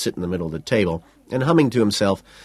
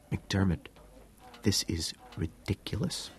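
hushed, much softer; whisper; 11 dB quieter than usual. A narrator's reading. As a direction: say it under your breath. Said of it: "I whisper".

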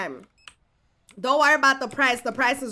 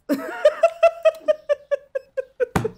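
high burst of laughter; 13 semitones higher than usual. Real, not acted. Owner laughing at her own price claim, high laugh. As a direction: let the laugh spill out high.

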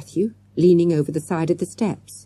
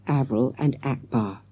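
plain reading voice

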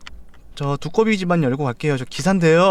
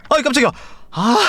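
a sudden jumpy yelp, pitched up; startled; 6 semitones higher, faster than usual. Real, not acted. Jumping at the dog, startled. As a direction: jolt of real fright in the voice.

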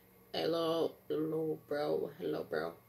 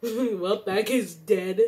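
ordinary talk, nothing marked

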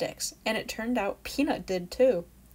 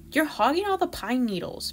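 higher, louder whiny complaint; a squirrel child's whiny grumble; complaining; 7 semitones higher, 6 dB louder than usual. Acted. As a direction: gripe like a cooped-up kid. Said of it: "Beck complained to Peanut".

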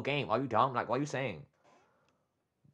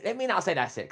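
heated outburst; 6 semitones higher than usual. Real, not acted. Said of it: "Let me calm down".

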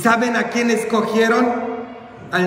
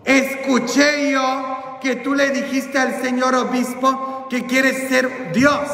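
high, drawn-out challenge; confronting grandfather; 7 semitones higher than usual. Acted. Grandfather confronts the boy about his words, high challenge. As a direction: challenge it high, stretching the end. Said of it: "mi abuelito, que nunca iba a la iglesia, dijo".

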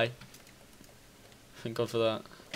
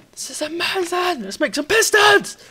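high-pitched excited cry, building louder; thrilled; 14 semitones higher, 6 dB louder than usual. Real, not acted. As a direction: squeal with excitement at the plan. Thrilled at having piston materials.

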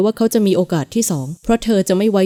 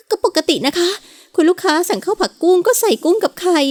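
higher, timid waitress voice; nervous waitress; 11 semitones higher than usual. Acted. Waitress answering the angry customer, higher and nervous. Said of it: "ตอบกลับด้วยน้ำเสียงสั่นๆ".

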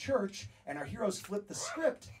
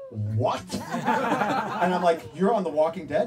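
stunned disbelief; 9 dB louder than usual. Retold or imitated. Fan claims to be on show.

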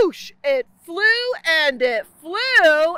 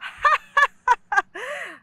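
high laughing; 8 semitones higher than usual. A narrator's reading. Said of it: "they started to laugh".

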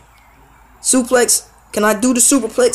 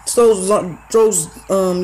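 normal talking voice

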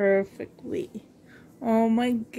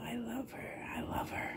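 soft unvoiced whisper of affection; 15 dB quieter than usual. Real, not acted. Vlogger whispering love to her doll Molly, soft whisper. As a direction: breathe the words out tenderly without voice.

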